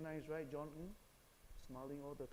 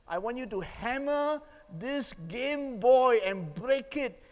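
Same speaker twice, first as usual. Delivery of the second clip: loud, high, emphatic orders; reenacted parental rage; angry father; 8 semitones higher, 12 dB louder than usual. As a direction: lay down the law to your son, furiously. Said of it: "I was so angry".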